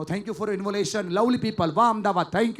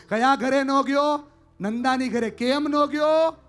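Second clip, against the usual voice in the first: slow, higher, louder probing questions; questioning mother; 8 semitones higher, 7 dB louder, slower than usual. Retold or imitated. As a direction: press the son slowly, word by word. Mother cross-examines son. (દીકરાને પૂછતી માં, ધીમે ભારથી)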